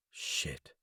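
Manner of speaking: whispered under the breath; muttered curse. A narrator's reading. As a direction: swear quietly to yourself.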